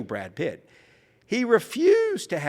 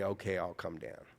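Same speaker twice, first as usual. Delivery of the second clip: grudgingly; 5 semitones lower, 10 dB quieter than usual. Retold or imitated. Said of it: "he said grudgingly".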